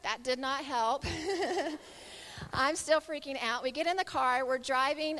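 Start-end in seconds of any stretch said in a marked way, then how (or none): 1.1-2.5 higher-pitched chuckle; laugh. Real, not acted. Speaker joking that prayer didn't help, amused laugh. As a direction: chuckle at your own punchline.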